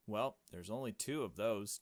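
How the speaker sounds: ordinary talk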